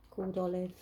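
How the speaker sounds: usual speaking voice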